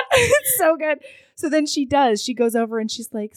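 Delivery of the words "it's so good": high laughing squeal. Real, not acted. Laughing at the show.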